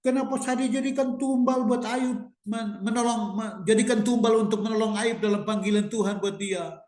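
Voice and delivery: high-pitched mock crying plea; sustained high whine of complaint; imitated tearful prayer. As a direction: wail plaintively at God, imitating someone in tears. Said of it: "tiba-tiba dia nangis".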